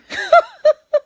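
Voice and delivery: loud high squeal of laughter. Real, not acted. Laughing at own joke.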